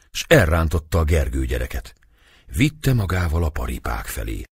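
ordinary delivery

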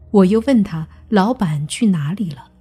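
ordinary delivery

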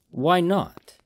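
high-pitched, challenging comeback; retort. A narrator's reading. Haman challenging the general, high retort. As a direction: throw it back, pitched well up. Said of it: "Haman retorted".

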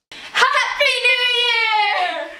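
high, slow, stretched-out cheer; excited shout. Real, not acted. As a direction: shout the greeting to the viewers. Shouting sign-off to viewers.

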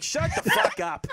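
put-on slow, angry Boston-accent snap; Boston accent coming out. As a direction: let the Boston accent slip out as you snap back. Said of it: "I'm like".

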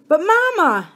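high, loud, anguished protest; crying out. A narrator's reading. Boy cries out to Mama to spare his dog, high and loud. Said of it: "I cried out".

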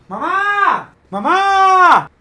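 loud, high yell of mom; shouting for mom. Real, not acted. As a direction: call out in panic. Calling out in shock.

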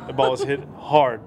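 deliberate deadpan "The ball is hit hard". Retold or imitated. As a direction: say it plainly, like a fact. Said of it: "like that".

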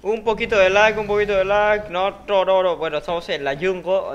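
normal talking voice

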